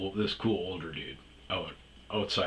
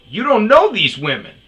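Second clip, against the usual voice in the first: loud, higher angry yell; 13 semitones higher, 15 dB louder than usual. Retold or imitated. Narrator defending the women from the old guy, yelling. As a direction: cut him down hard. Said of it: "It was more yelling".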